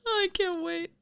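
high, mock-thrilled; sarcastic. Wife mocking the endless mall tour, sarcastic. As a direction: fake excitement dripping with irony. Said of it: "sarcastic reply to mall tour".

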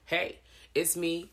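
normal talking voice

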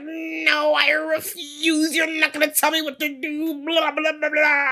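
whiny stubborn man. Retold or imitated. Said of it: "And he's like".

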